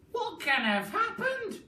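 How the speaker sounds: put-on very high old woman's voice; surprised Mrs Twit. Wife surprised at her stick, high crone voice.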